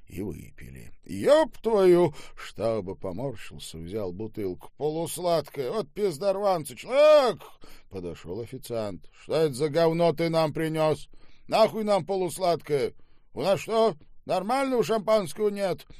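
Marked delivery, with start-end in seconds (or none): 1.0-2.1 loud, high cursing; disgusted outburst. Acted. Boss curses at the bottle he tasted, disgusted outburst. 4.8-7.4 rising, high-pitched angry shout; outraged complaint. Acted. Boss rages over champagne and yells for the waiter. 9.2-15.9 loud, high-pitched tirade; angry berating. Acted. Boss berates the waiter over bad champagne, angrily.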